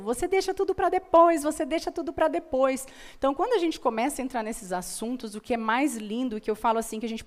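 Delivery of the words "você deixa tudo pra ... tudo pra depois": nagging mother. Retold or imitated. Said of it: "a mãe intensificava isso".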